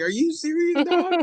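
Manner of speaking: high, laughing mock disbelief. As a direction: ask it through a laugh, half-joking. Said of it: "I was like".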